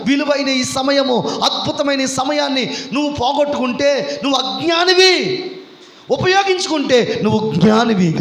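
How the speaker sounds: building sermon cry, rising then slowing; preaching at full force. Acted. Pastor exhorts the congregation toward baptism, building to a shout. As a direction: drive the list home to the congregation.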